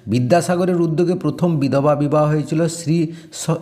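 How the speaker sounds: usual speaking voice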